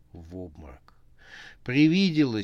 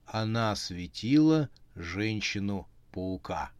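plain reading voice

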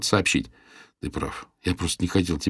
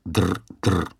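flat ring imitation. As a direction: copy the ring lazily. Steve copies the ring flatly.

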